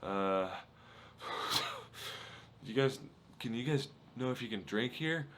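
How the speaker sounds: imitating a winded, nervous stranger with puffed breath; nervous, rattled. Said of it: "so nervous and rattled".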